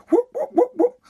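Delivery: high falsetto whoops with a laugh; mock whoop. Alone shoveling snow, high laughing whoops. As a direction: toss off silly high whoops.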